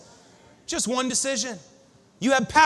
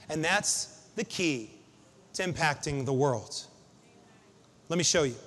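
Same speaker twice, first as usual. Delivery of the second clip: quiet reflective preaching; 6 semitones lower, 6 dB quieter than usual. Real, not acted. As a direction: draw the room into stillness.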